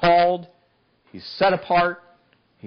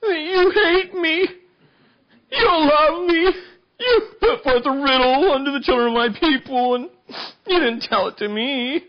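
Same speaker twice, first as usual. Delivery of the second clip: hammed-up crying plea; mock weeping wife; 13 semitones higher, 3 dB louder than usual. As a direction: wail it like a sulking wife. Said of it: "Samson's wife wept before him and said".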